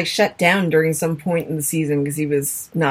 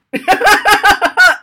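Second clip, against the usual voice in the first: high, loud burst of laughter; 12 semitones higher, 9 dB louder than usual. Real, not acted. Co-host teased as a pedant, laughing hard. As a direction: laugh out loud, pitched well up.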